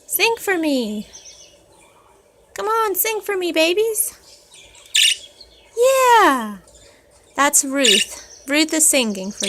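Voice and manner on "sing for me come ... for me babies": high, coaxing pet voice. Real, not acted. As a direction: sweet-talk the birds into singing. Talking to her pet birds.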